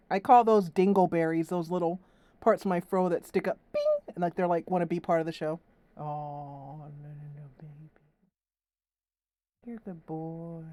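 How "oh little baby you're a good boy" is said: hushed cooing. Real, not acted. Owner murmurs to her cat held on camera, hushed cooing. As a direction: murmur sweetly right by his ear.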